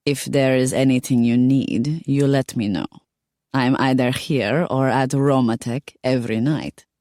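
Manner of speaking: put-on accented foreign woman, helpful; lightly accented, obliging offer; Radinka, friendly. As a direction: voice a courteous foreign lady. Voices Radinka in dialogue.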